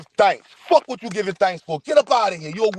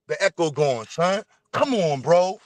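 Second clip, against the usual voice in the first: normal talking voice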